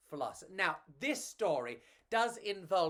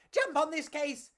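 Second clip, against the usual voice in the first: high, louder bug command; cockroach voice; 11 semitones higher, 5 dB louder than usual. Acted. Pedro telling Enrico to hop on a suitcase, high eager. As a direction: urge your friend on, tiny and eager. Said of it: "Pedro said".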